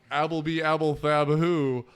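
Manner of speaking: Grinch spitting names from the phone book, dragging out I hate you very slowly; Grinch reading names. Retold or imitated. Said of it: "and he goes".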